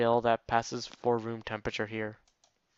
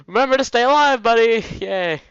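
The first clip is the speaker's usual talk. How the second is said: high, loud, gleeful mock pep talk; mock cheerleading; 11 semitones higher, 8 dB louder than usual. Player teasing the hero about pills, mock-cheerful. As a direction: cheer them on with over-the-top glee.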